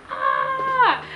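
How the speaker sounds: high, loud shriek of joy; shrieking with disbelief at the test; excited scream. Retold or imitated. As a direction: let out a thrilled scream. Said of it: "I screamed so loud".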